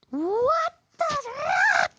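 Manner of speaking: very high, drawn-out scream; exasperated shriek. Real, not acted. Player losing at the game, exasperated shriek. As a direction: shriek it slowly in disbelief.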